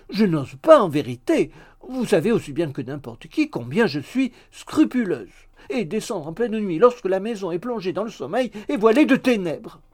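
loud, dramatic coy refusal; prim maid, protesting. A narrator's reading. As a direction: protest your scruples with theatrical dread. (servante prude qui proteste avec emphase)

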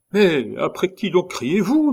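high, loud, exasperated question; indignant calling. A narrator's reading. Gertrude answering the count's frantic shouts, indignant calling. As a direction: call back as if he were being absurd.

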